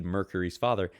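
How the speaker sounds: ordinary delivery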